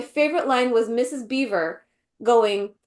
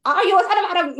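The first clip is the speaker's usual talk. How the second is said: higher-pitched mock crazy bag lady; 7 semitones higher, 4 dB louder, faster than usual. Viewer mimicking the Queen meeting Edmund, crazy bag lady. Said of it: "this crazy bag lady".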